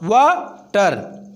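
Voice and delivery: वाटर slowly in two parts, वा, टर; split into syllables. Shown as a demonstration. Teacher showing a two-syllable word, वाटर split in two. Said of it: "दो शब्दों का syllable भी बताया था जैसे".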